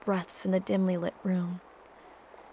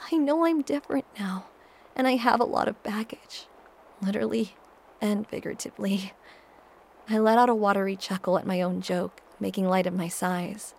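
ordinary delivery

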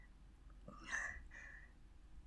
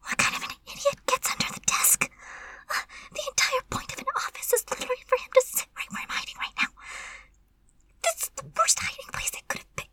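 high, rushed, breathy whisper; frantic hiding whisper; 11 semitones higher, faster than usual. Acted. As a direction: berate yourself in a panicked whisper. Hiding under desk, scolding self.

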